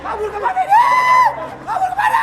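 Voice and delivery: high-pitched panicked cry; panicked yelling. Real, not acted. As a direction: wail the last word in fright. Frightened in haunted ride.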